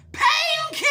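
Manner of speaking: high, loud metal wail; metal scream. Shown as a demonstration. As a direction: belt it like a metal frontman. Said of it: "metal vocalists who are really going for it".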